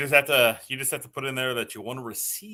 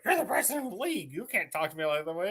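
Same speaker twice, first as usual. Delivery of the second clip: offended coach mimicked; 6 semitones higher than usual. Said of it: "he's like".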